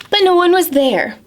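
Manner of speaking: usual speaking voice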